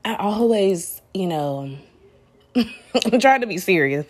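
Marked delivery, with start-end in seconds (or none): none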